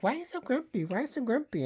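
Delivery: high, curious cat voice. Pretend. Owner voicing her cat asking why she's grumpy, high and curious. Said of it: "Moneypenny was like".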